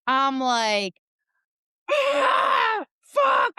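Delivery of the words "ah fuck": high, loud frustrated curse; cursing in frustration. Retold or imitated. Fixing the dryer in a hot basement, loud high curse. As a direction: yell it like the door just slipped again. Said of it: "I'm, like, cursing".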